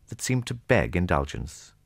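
ordinary delivery

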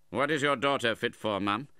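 loud, high, curt question; brusque demand. A narrator's reading. Uncle quizzes widow about her daughter, brusque.

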